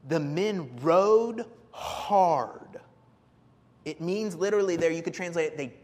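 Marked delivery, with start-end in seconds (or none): 0.1-2.8 very slow. Shown as a demonstration. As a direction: drag out each word, very slow. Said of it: "It says in verse thirteen".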